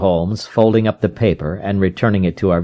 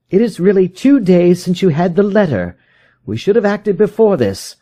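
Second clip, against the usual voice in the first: higher, pressing detective's voice; urgent Holmes; 10 semitones higher than usual. A narrator's reading. Holmes urges the frightened visitor, higher and pressing. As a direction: rouse a despairing man to action.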